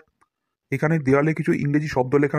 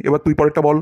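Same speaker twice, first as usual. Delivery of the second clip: usual speaking voice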